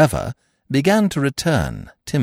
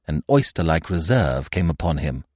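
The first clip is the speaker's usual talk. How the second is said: usual speaking voice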